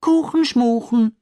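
high, loud, slow mocking rhyme; a hint of a cheeky boy. A narrator's reading. Max mocks the neighbour in a high, loud child voice. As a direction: tease it out like a cheeky kid. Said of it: "sagt Max".

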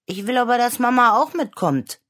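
put-on a small girl asking that Mama come too; pleading little girl. Clara begging her father to bring Mama along, high pleading child voice.

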